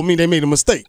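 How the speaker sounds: usual speaking voice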